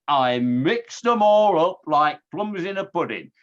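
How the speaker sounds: a hammed-up Yorkshire twang on the quote; Yorkshire accent. Quoting Baden-Powell to the audience in a mock Yorkshire accent. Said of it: "he didn't say it in a Yorkshire accent".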